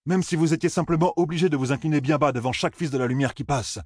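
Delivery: high-pitched, pointed challenge; Perrine, challenging. Acted.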